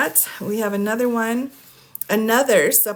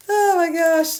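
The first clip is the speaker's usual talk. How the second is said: much higher, louder gasp; mock shock; 10 semitones higher, 5 dB louder than usual. Prosecutor reacting to the motion, mock shocked. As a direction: play the prosecutor aghast. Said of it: "Jack was like".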